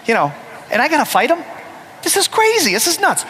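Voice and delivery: high, rushed panic building to a yelp; thinking in sheer alarm, voice shooting up; panicked inner voice. Retold or imitated. [name] panicking inside as he faces Big Jim. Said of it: "And I'm thinking".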